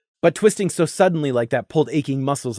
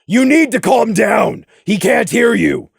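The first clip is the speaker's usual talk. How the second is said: shouting; 5 semitones higher, 4 dB louder than usual. A narrator's reading. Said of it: "Nimbus shouted above the storm".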